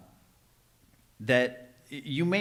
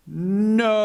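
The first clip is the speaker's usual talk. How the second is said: loud, higher flat refusal: "No."; anteater's blunt no; 8 semitones higher, 6 dB louder than usual. Acted. The anteater refusing the bartender's beer, loud and high. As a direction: bark a sudden "No." up high.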